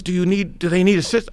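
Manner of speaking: ready to rush out and help; urgent fireman. Retold or imitated. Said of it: "He goes".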